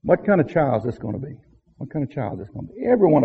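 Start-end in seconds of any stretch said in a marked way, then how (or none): 1.8-2.9 much softer, quick repeated question; soft echo. Shown as a demonstration. Parents wondering about their child, softer echo. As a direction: echo the question under your breath. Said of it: "and we wonder".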